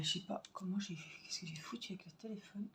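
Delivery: whispered aside. Real, not acted. Muttering aside while fiddling with phone.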